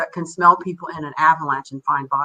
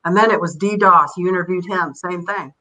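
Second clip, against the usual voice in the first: normal talking voice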